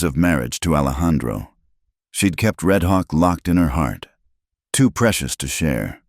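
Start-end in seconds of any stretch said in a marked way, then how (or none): none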